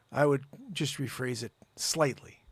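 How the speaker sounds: ordinary talk, nothing marked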